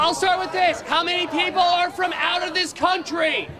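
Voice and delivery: high, projected question to a crowd; shouted poll of the audience; calling to crowd. Real, not acted. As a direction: pitch it up so the whole line hears. Host addresses the whole crowd.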